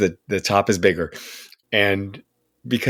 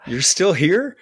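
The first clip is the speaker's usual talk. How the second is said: amazed exclamation; 8 semitones higher than usual. Retold or imitated. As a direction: blurt it out in disbelief. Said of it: "he said".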